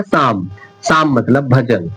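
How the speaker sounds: साम said slowly with no p sound; silent p. Shown as a demonstration. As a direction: draw out साम, the p left out. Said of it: "ये पी silent हो जाएगा". (धीरे से साम, पी silent)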